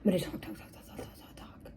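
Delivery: talk, talk fading into a whisper; fading whispered chatter. Retold or imitated. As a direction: let the repeated words trail off to nothing. Mimics her own chatter, fading.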